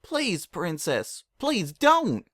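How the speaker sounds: beg, rising in desperation; a desperate plea to the princess; pleading. A narrator's reading. Applejack begs.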